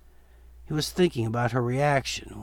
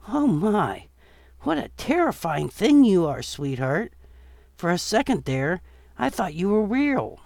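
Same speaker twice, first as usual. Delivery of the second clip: put-on higher, fluttery elderly woman, amused; sweet old lady voice; 7 semitones higher, 4 dB louder than usual. Elderly witch-costumed woman cooing at the zombie boy, high and sweet. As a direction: coo like a delighted grandma.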